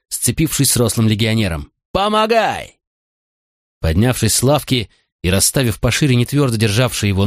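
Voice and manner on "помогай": much higher yell for help. A narrator's reading. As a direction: scream for help mid-fight. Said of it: "орал Макрон".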